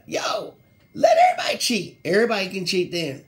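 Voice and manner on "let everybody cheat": much higher, louder shout; excited shout. Real, not acted. Host ranting to viewers about baseball, high excited shout. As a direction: yell it out to the crowd.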